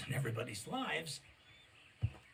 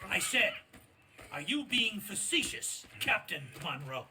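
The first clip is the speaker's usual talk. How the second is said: louder, higher repeated demand; insisting angrily; 5 semitones higher, 6 dB louder than usual. Acted. Colonel repeats his demand to Captain Monroe, louder and higher. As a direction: press him, losing patience.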